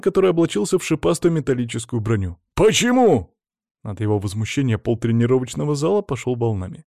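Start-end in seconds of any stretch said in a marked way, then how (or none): 2.5-3.3 high, loud, indignant why; furious squawked question; enraged. A narrator's reading. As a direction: yell it in outrage. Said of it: "тут же взбеленился Колян".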